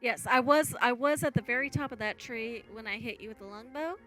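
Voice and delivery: normal talking voice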